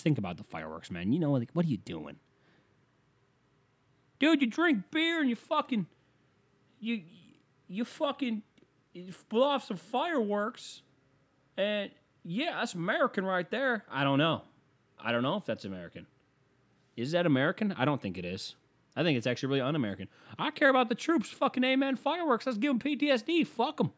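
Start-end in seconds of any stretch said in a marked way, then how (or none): 4.2-13.8 much higher, slow mocking dude voice; mock dumb patriot. Comedian mocking a fireworks fan in a high dumb voice. 20.4-23.9 much higher cartoon dude voice; squeaky mocking voice of a fireworks idiot; mock dumb patriot. Comedian lampooning fireworks fans in a high dumb voice.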